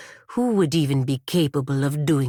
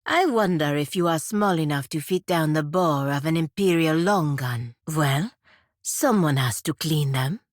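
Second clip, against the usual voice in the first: ordinary talk, nothing marked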